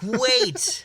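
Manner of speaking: high-pitched urgent call; calling out urgently. Acted. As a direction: cry out to stop everyone. Calling out to halt departing plane.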